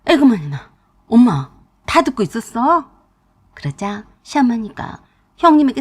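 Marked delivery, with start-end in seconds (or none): 0.1-2.9 startled cry. Retold or imitated. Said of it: "놀란 형님이 뒤로 나자빠지면서".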